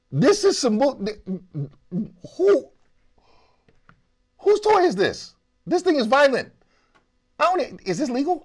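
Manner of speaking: high, loud disbelief; outraged surprise at the toy; shocked. Real, not acted.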